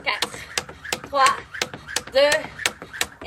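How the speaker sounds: calling out the final seconds. Real, not acted. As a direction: shout the time left, rising. Countdown to end of set.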